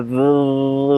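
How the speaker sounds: "the" as a long buzzing th, tongue between teeth; drawn-out th exercise. Shown as a demonstration. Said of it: "n'hésitez pas à vous entraîner, hein. Vous dites". (« the » en th vibré et allongé)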